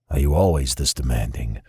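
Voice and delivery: low, dry and unimpressed; dry jab. A narrator's reading. Remington asks Alina if she's always demanding, dry and low. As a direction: drop it low and deadpan.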